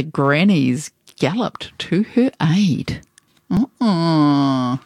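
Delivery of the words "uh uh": ordinary delivery, nothing marked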